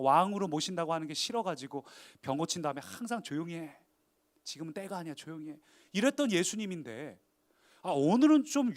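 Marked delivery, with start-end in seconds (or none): 3.2-5.6 subdued, private warning; hushing. Acted. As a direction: hush them discreetly. Jesus hushing those he healed.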